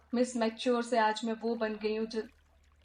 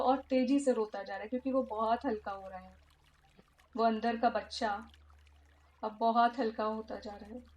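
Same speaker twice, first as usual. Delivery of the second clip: hushed therapist leading a healing exercise; guided meditation. Acted. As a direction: guide the listener gently through the visualization. Leads inner child meditation.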